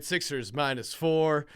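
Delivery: ordinary delivery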